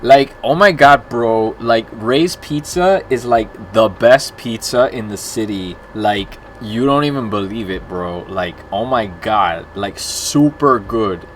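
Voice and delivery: gushing, emphatic Miami accent. Shown as a demonstration. Streamer showing off the accent on a viewer's request, exaggerated Miami accent. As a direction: lay on a Miami accent, drawing out the key words. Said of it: "In my Miami accent".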